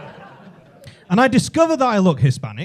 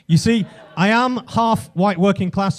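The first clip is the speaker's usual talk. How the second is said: ordinary talk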